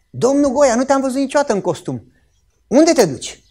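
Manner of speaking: high, loud astonished questioning; surprised greeting. Retold or imitated. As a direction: imitate someone amazed at the sight of you. Acting astonished person at the vet's.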